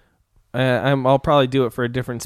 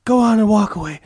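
high, heated police boast; Denzel rant impression; 7 semitones higher than usual. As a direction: strut and threaten the crowd. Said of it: "Denzel Washington's character".

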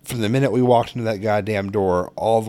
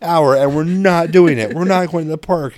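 stern dad reply; 6 semitones higher, 4 dB louder than usual. Retold or imitated. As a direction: lay down the law to the kid. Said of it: "And I'm like".

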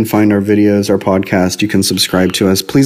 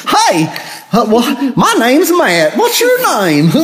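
hammed-up high hillbilly drawl; country person accent; 13 semitones higher, slower than usual. Shown as a demonstration. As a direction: do an over-the-top high country bumpkin accent. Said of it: "like, a plain country person accent".

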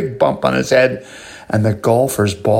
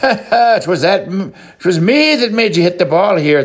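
high leprechaun voice; 7 semitones higher than usual. A narrator's reading. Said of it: "the little guy says".

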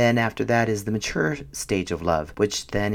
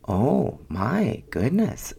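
slow, drawn-out delight with swooping pitch; savoring, word-by-word amazement; swooning reaction; much slower than usual. Retold or imitated. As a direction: stretch each word as if bowled over.

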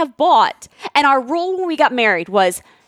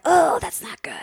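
whispered, fake concern; hushed sympathetic whisper; whispered sympathy; 4 semitones higher, 3 dB quieter than usual. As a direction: breathe it out in mock pity.

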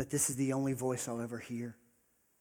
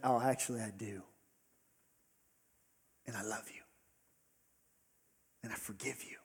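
much softer, hushed reassurance; gentle whisper; 4 semitones lower, 9 dB quieter, faster than usual. Acted. Jesus quietly forgiving Judas, hushed tender voice. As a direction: say it quietly, like a secret kindness.

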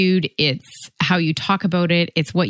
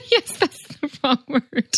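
laughing; 9 semitones higher than usual. Real, not acted. Laughing at partner's slip.

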